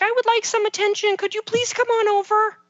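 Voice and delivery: put-on high, sweet, polite child voice; child politely asking for attention. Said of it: "I'm hoping kids will be like".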